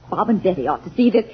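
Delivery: ordinary delivery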